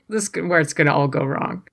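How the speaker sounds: ordinary talk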